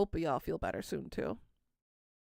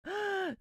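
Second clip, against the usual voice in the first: excited gasp; 12 semitones higher than usual. Real, not acted. Excited gasp on seeing a friend.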